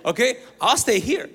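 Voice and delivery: loud, high-pitched comic quarrel; arguing. Retold or imitated. Brother refusing to go back into the room, arguing loudly. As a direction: fire the order back, acting indignant. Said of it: "We argue for".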